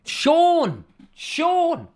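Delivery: high, hollered name; loud greeting. Real, not acted. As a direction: call out to a regular caller with a big shout. Greeting the regular caller loudly.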